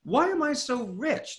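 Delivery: Why am I so rich asked high and slow with rising tune. Shown as a demonstration. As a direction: float the question up in wonder. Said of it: "my next thought was".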